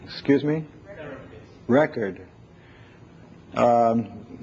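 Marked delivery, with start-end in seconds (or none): none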